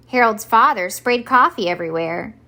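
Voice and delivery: usual speaking voice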